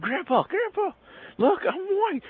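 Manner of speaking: very high, mock-gleeful child squeal; excited kid. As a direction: call grandpa like a thrilled little boy. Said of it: "all excited and everything".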